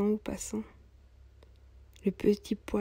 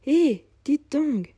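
calling out; 6 semitones higher, 7 dB louder, much slower than usual. Acted. Old cobbler calls to passing woman.

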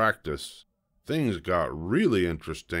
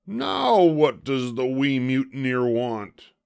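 loud, higher pirate captain; demanding pirate; 7 semitones higher, 5 dB louder than usual. A narrator's reading. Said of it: "Braidbeard demanded".

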